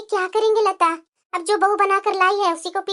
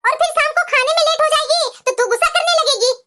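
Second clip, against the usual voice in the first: higher, pleading with mother; protesting daughter; 5 semitones higher than usual. Acted. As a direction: defend the sister-in-law, voice up. Daughter defends bhabhi to mother.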